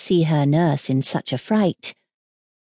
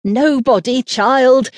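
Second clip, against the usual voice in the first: higher, mildly cross nurse; a touch of anger, pitched up; almost angry; 6 semitones higher, 5 dB louder, slower than usual. A narrator's reading. Nurse answering the frightened princess, almost angrily. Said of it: "almost angrily".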